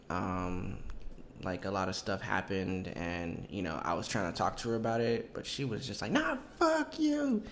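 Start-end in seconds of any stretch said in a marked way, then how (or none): 6.1-7.4 put-on high, slow, slurring drunk ex; mocking drunk girlfriend, pitched way up; drunk ex impression. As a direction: wail it in a much higher, drawn-out drunk voice. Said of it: "she definitely was fucking drunk".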